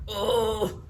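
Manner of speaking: a tight pushing grunt; pained grunt. Retold or imitated. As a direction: strain it out like bearing down. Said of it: "Like that".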